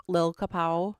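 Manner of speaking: usual speaking voice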